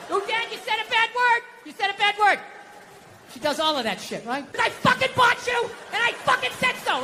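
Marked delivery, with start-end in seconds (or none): 0.1-2.4 put-on high-pitched child tattling; high little girl's voice, acted; imitated little daughter. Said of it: "the second I hear that voice". 4.6-7.0 high-pitched furious acted yell; hammed-up shouting fit; screaming at a gadget. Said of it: "don't scream like that".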